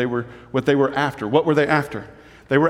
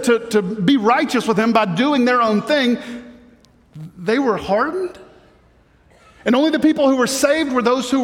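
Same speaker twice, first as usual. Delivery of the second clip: higher-pitched, questioning listener; lightly acted puzzlement, pitched up; imagined troubled questioner; 7 semitones higher than usual. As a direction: voice a listener's doubt, lifting into questions. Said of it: "here would be the question".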